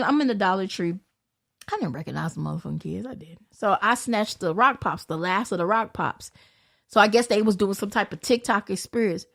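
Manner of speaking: usual speaking voice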